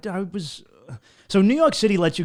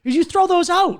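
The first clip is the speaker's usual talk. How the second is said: high, louder, incredulous question; girl's disbelief; 6 dB louder than usual. Retold or imitated. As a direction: act out her shock at the idea. Voicing the girl in disbelief.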